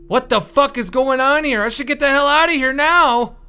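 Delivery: high loud panicked yelling; panicked shout. Real, not acted. Player panics at the scene and wants out, high loud shout. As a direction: shout in real alarm.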